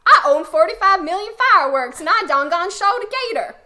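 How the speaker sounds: loud, high hammed-up American country accent; mock American accent. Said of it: "Whereas Americans are like".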